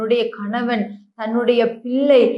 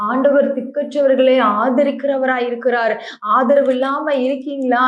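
ordinary talk, nothing marked

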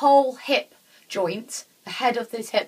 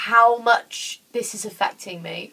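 usual speaking voice

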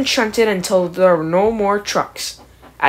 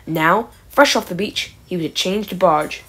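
plain reading voice

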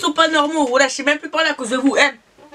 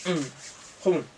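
muffled chewing speech; talking while chewing; 13 semitones lower, 9 dB quieter than usual. Real, not acted. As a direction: mouth partly full. Seen 2: Cheeks full, chewing after sandwich bites; wipes mouth with tissue.

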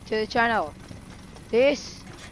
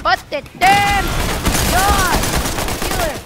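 much higher, louder yells; much higher, louder frantic yells; frantic yelling; 9 semitones higher, 6 dB louder than usual. Real, not acted. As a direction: scream through the chaos. Frantic end fight.

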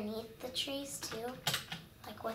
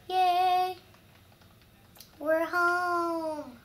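higher, louder happy cheer; 5 semitones higher, 7 dB louder, much slower than usual. Real, not acted. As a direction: celebrate getting home. Cheering on arriving home.

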